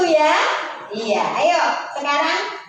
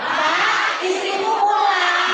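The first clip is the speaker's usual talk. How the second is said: wife's greeting; 6 semitones higher, 4 dB louder, much slower than usual. Shown as a demonstration. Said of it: "Satu, dua, tiga".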